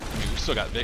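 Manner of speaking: ordinary talk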